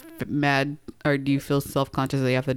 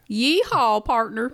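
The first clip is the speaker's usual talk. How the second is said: Western accent; 7 semitones higher, much slower than usual. Shown as a demonstration. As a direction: belt out a Western accent greeting. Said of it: "Can you do, like, a, a Western accent?".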